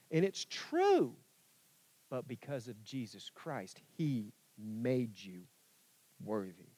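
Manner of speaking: ordinary talk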